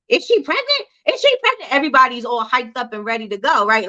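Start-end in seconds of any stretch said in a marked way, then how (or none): 0.1-1.7 much higher, eager gossiping gasp over big news; excited gossip. Retold or imitated. Fan watching the halftime show, buzzing with excited gossip. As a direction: squeal it like you just spotted a bump. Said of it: "or you'd be like".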